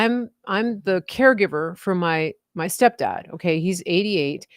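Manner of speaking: ordinary talk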